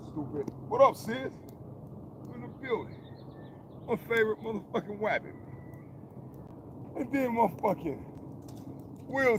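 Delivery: high squeaky voice; squeaky greeting. Real, not acted. Greeting his viewers in a high squeaky voice. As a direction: squeak it out playfully.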